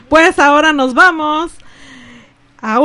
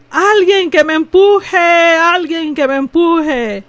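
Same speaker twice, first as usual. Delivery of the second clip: put-on slow, higher, slurring drunk call; town drunk; 5 semitones higher, 3 dB louder, slower than usual. A narrator's reading. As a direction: drawl it out like a drunk calling for help. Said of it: "un borracho gritaba".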